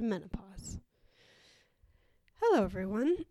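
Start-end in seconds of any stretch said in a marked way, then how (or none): 0.0-0.8 playful hushed whisper. Acted. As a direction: whisper it like a secret. Whispers the taboo word in intro.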